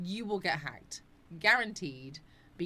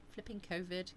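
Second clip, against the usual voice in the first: ordinary delivery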